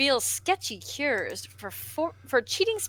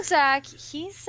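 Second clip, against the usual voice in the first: ordinary delivery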